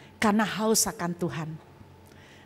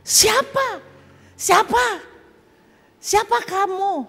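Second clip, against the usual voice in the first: much higher, louder alarmed Siapa?; startled, frightened; 10 semitones higher, 4 dB louder, slower than usual. Retold or imitated. The youth asking the unseen voice who it is, high and frightened.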